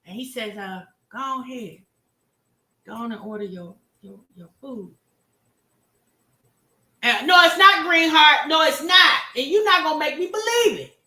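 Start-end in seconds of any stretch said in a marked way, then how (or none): 1.1-4.9 put-on soft polite boyfriend. 7.2-11.0 high, loud indignant denial; hammed-up woman refusing; mock woman protesting. Voicing Wineglass protesting.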